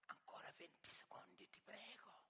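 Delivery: pleading under the breath; whispered plea to the hanging man; urgent whisper. Acted. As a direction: beg him softly to hold on. Bettor urging hanged man to last.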